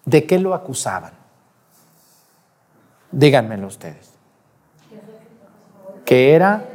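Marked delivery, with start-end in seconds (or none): none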